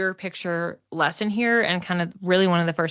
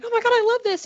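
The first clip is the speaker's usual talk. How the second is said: imitating a squealing rave, far higher; mock over-the-moon delight; overexcited gush; 3 dB louder, much faster than usual. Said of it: "he's seeing you like".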